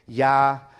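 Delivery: cortado en "Ya-"; Ya- cut off. Shown as a demonstration. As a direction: empieza "Ya-" y se corta. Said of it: "Yahová". (pronunciación cortada "Ya-")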